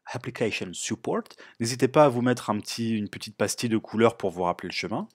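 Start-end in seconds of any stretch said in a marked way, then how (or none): 0.1-1.2 softer, mock American English pronunciation; put-on American accent. Shown as a demonstration. Tutorial host repeating a Mac folder name, put-on American accent. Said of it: "avec mon super accent américain".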